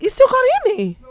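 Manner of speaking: high loud shout. Real, not acted. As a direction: yell it over the room. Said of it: "Why are you shouting?".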